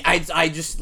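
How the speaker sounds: usual speaking voice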